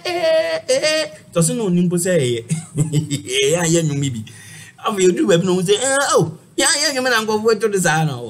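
high, loud hammed-up exclaiming; mock worshipper. Continues mock church performance.

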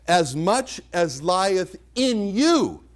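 "you" drawn out at a slow pace; stress on YOU. Shown as a demonstration. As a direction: lean long and hard on "you". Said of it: "the emphasis on this verse is on the word you".

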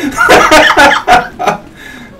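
high laughing. Real, not acted. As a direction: burst out laughing. Laughing at the fake accent.

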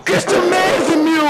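high outraged shout; raging gamer. Real, not acted. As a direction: yell at the game.

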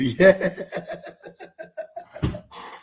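high breathy mocking laugh. Real, not acted. Vlogger laughing at his critic, high mocking laugh. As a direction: laugh at them.

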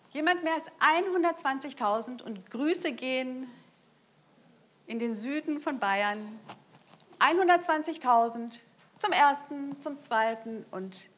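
loud, rising going-once call; auctioneer call. Real, not acted. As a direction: drive the room to the final hammer. Final hammer call at auction.

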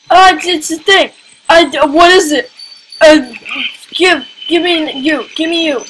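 freaked-out shouting at the screen; startled loud. Real, not acted. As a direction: panicky cries at a strange thing. Startled by a strange creature.

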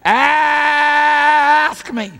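huge high scream on a long-held ASK; drawn-out pleading yell; screaming at top of lungs. Acted. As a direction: belt it out with full lungs. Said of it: "God's screaming at the top of his lungs".

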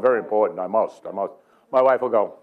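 ordinary talk, nothing marked

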